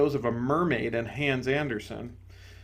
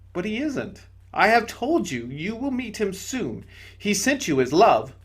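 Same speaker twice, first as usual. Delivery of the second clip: higher, gentle spirit voice; serene bright spirit; 7 semitones higher, 3 dB louder than usual. Acted. Bright spirit to the ghost, higher and gentle. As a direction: soothe him from above.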